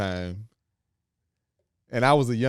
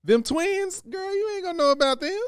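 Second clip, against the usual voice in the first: much higher, playful teasing; excited tease; 3 dB louder than usual. Real, not acted. Wife teasing husband about the twins, high and playful. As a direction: hype up the secret.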